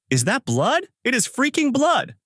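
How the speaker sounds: very high, shocked outburst; shocked exclamation. A narrator's reading. Professor Mori sees blood on Takashi, shocked. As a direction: exclaim in alarm, pitch shooting up.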